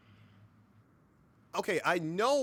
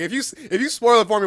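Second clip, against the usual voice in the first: mock outburst; 8 semitones higher, 10 dB louder, faster than usual. Real, not acted. Mock outrage at spoiler threat.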